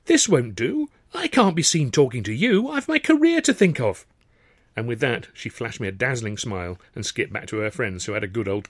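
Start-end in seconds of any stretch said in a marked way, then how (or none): 0.0-4.0 high, hammed-up flustered diva; a chorus girl pretending to fret over her career; mock fluster. A narrator's reading. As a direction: fake alarm at being seen with him. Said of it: "pretended to come over all flustered".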